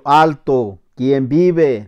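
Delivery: loud halt and challenge; sentry's challenge. Retold or imitated. Soldier with M16 repeating his challenge, loud sentry's call. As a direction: stop him and demand who goes there.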